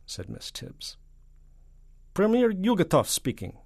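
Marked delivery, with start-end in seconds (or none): 2.1-3.6 high, loud voice of a Soviet leader; Soviet premier. Acted. Soviet premier answering the hotline, high and loud. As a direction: announce yourself grandly, pitched high. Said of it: "said the voice from Moscow".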